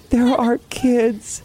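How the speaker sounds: high, slow, tearful sobbing voice; retold sobbing. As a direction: let the voice break high and slow as tears come. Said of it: "literally sobbing on her couch".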